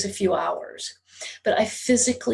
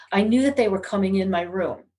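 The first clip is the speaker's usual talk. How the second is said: usual speaking voice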